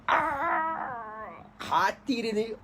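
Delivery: high, strained voice with a cry in it; aching grief. Acted. Yusuf remembering his brothers' cruelty, crying out. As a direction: let the old hurt break through. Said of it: "sedih lah ingat cerita lama ni".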